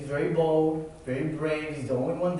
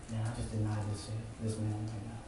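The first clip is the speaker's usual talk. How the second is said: a soft, low murmur of regret; hushed realization; 6 semitones lower, 12 dB quieter than usual. Acted. Peter realizing he denied Jesus, hushed and low. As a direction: let it drop almost to a hush.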